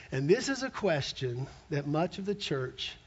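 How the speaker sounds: ordinary delivery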